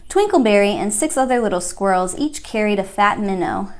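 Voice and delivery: ordinary delivery, nothing marked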